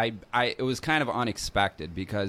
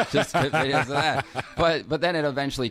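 laughing; 6 semitones higher, faster than usual. Real, not acted. Laughing recalling brothers getting mad.

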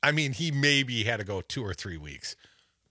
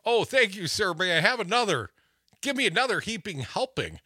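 high, loud, mock-grateful begging; eager glutton for punishment; 8 semitones higher, 5 dB louder than usual. Mocking Trump supporters asking for more, mock-eager. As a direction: plead eagerly for more in a raised voice. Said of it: "They want".

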